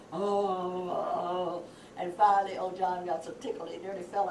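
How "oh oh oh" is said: long drawn-out "oh" moans; retold weird moan. Retelling the prank noise from the top story, moaning. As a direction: imitate an eerie moan from the dark. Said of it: "we hear them go".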